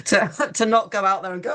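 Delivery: ordinary talk, nothing marked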